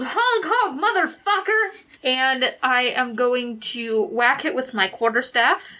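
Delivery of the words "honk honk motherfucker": high, mock yelled honk; yelling. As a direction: yell it at the goose. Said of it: "Gwyn yells".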